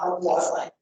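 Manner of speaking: ordinary delivery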